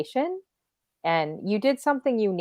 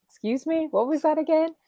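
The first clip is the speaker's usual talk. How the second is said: confused high voice; 10 semitones higher than usual. Retold or imitated. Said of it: "I'm like".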